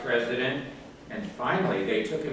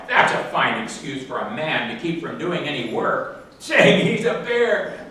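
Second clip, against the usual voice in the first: mocking foreman; 5 dB louder than usual. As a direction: sneer at the excuse. Foreman mocks bear.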